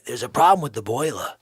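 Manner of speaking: ordinary delivery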